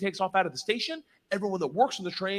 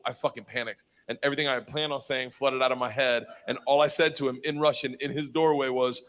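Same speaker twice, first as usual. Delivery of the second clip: usual speaking voice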